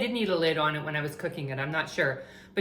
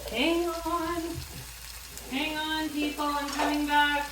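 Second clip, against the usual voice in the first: far-off, raised call from another room; 8 semitones higher, 5 dB quieter, slower than usual. Real, not acted. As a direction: call back from a distance. Calling out from off camera.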